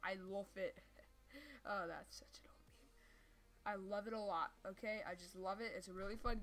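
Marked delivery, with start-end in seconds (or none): 1.7-3.7 whispering. Real, not acted. Aside about the meme.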